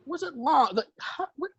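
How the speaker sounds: incredulous. Real, not acted. Disbelief that the movie was long.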